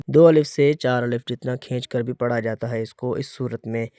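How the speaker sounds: ordinary talk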